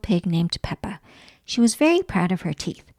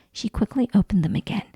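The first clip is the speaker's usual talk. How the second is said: usual speaking voice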